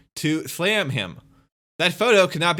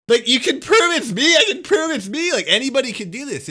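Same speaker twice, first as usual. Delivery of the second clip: mock eager honest streamer; 9 semitones higher, 6 dB louder than usual. Voicing how an honest person would sound.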